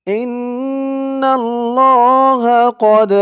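slow, melodic recitation of the verse; Quran recitation. Shown as a demonstration. Teacher reciting the verse for students to repeat. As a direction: chant it slowly and clearly. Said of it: "আমার সাথে বলুন".

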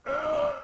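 loud, high acted yelp; pained cry. Man crunched by Robin's attack, loud pained cry. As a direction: cry out as if hit.

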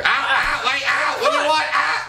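high-pitched, laughing yelps of protest; yelping. Real, not acted. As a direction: yelp in rapid, high bursts. Reacting to being hit in game.

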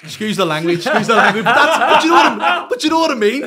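words spilling out through big laughter; laughing. Real, not acted. Laughing at his own crude line.

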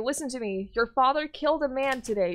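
ordinary talk, nothing marked

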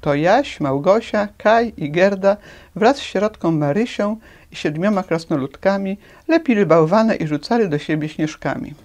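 ordinary delivery

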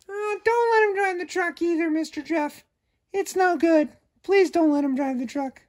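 very high, shy little dog voice; high timid puppet, afraid of the shark; timid puppy puppet. Acted. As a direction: squeak it out like a nervous puppy. Furball answers again.

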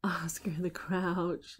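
slow, soft whisper; whispered aside. Real, not acted. Parent whispers the name to the child. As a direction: breathe the name quietly.

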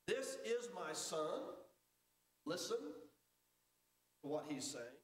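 slow, weighty, drawn out; slow solemn voice of God. Retold or imitated. Preacher voicing God slowly to the disciples. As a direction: speak the words of God slowly. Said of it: "God says to them".

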